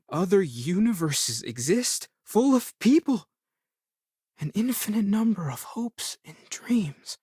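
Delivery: high, wondering voice, dropping breath at the end; awestruck. Acted. Marvelling that other universes exist, awestruck. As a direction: marvel aloud, pitch soaring on the discovery.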